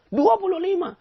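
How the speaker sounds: high, loud, squeaky dua puluh lima; stubborn man squeaking his wrong answer; stubborn insistence. Acted. Stubborn man insists once more.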